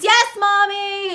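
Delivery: put-on high, slow, drawn-out kid reply; stretched-out childish answer; obedient little boy. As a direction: imitate a child answering mommy. Boy answers his mother.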